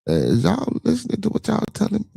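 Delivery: high-pitched incredulous question; retold high incredulous aside. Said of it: "looking at these motherfuckers like".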